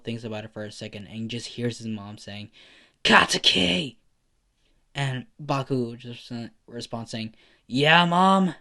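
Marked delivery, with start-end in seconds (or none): 3.0-4.0 loud high yell of a name; calling out. Acted. Mother calling her son, loud and high. Mom calls from the house. 7.7-8.5 loud, high shout back; calling back. Acted. Bakugo yelling back to his mother, loud and high.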